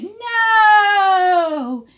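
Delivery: very high, loud chorus shout; loud cry. Shown as a demonstration. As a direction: lead the kids in shouting it. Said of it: "I want to hear you say".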